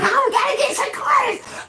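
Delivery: high-pitched fake frantic cry; mock panic. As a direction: ham up a panicked wail. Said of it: "acting like all panicky".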